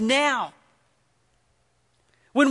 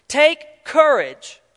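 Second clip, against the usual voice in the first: slow earnest plea; 4 semitones higher, 4 dB louder, much slower than usual. Acted. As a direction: stretch out take courage, slow and firm.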